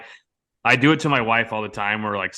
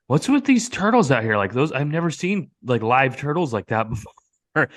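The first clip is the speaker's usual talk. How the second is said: innocent customer; 4 semitones higher than usual. Retold or imitated. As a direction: act innocently curious. Guest plays innocent customer.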